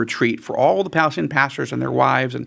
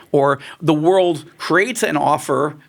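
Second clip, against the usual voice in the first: usual speaking voice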